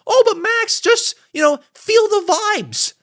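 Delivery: high, mocking falsetto of a fan. Mocking an imagined fan's objection.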